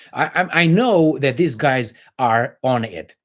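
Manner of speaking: normal talking voice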